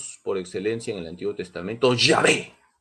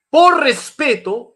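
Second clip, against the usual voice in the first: "por respeto" pushed up high and loud; emphatic; 11 semitones higher, 10 dB louder, slower than usual. Acted. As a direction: lift "por respeto" as the key point.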